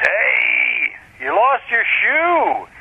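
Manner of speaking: high, drawn-out call out of a truck window; buddy calling out. Retold or imitated. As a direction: stretch it out slowly to the kid. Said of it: "and he goes".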